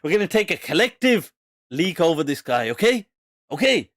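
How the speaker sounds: forceful, insistent mimicry, rising at the end; worked-up driver. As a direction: imitate him pushing for agreement. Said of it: "he got really worked up".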